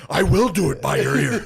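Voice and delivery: high, very loud defiant yell; hammed-up, over-excited retort; imitated yelling. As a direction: bellow it back in mock defiance. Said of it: "He starts yelling that".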